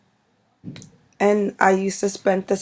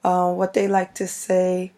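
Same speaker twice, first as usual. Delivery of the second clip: ordinary delivery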